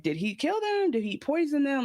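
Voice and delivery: high, alarmed questions; alarmed wondering. Retold or imitated. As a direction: sound shocked at the thought. Speculating about fresh bodies.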